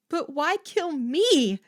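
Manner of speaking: high, loud terrified plea; frightened cry. Acted. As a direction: cry out in panic. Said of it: "cried in a shrill, frightened voice".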